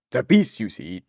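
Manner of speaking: plain reading voice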